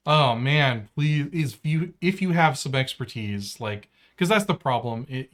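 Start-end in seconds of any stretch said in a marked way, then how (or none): none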